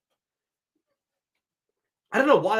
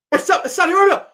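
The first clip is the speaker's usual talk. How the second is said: high, loud burst of delight; excited outburst; 10 semitones higher, 8 dB louder, faster than usual. Real, not acted. Fan watching the draw gets his wish, excited yelp. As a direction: yelp it out as your hoped-for team lands. Drawn Saudi Arabia he hoped for.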